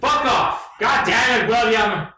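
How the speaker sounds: high yelled outburst; angry shout. Acted. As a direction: shout it in mock fury. Mock outburst at cohost.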